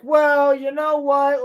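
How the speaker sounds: shouting in a mock huff. Halbrand acting out, shouting.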